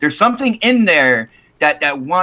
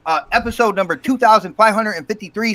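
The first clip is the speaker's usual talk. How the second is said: ordinary delivery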